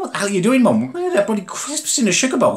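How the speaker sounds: confronting mum over crisps in the sugar bowl, a high exasperated yell; exasperated retold shout. Retells confronting his mum.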